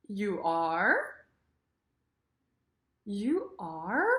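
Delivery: "are" sweeping upward; questioning "You are?". Shown as a demonstration. Teacher models questions for learners, rising "are". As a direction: ask with "are" climbing to the end. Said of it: "sentences and questions".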